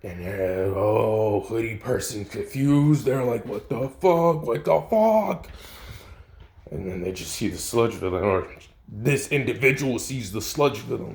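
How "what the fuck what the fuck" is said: climbing higher on the repeat, stretched; higher freaked-out disbelief. Acted. Hoodie person waking up among claw marks, higher and freaked out. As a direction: lose it more the second time.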